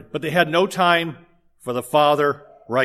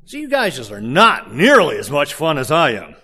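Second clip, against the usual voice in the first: ordinary talk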